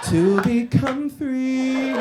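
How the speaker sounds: drawn-out mock-mystic declaration; mock mystical proclamation. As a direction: intone it grandly like a fake prophet. Mock spooky pronouncement.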